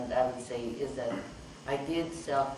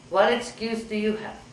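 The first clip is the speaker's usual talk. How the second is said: higher, louder indignant demand; patient confronting the doctor, indignant and louder; retold demand; 8 semitones higher, 5 dB louder than usual. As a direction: mimic the patient's accusing challenge. Angry patient demands an excuse.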